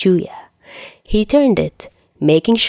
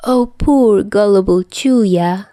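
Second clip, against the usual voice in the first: condescending; 3 semitones higher, slower than usual. A narrator's reading. Dazai condescending, leaning close.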